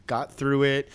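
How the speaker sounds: ordinary delivery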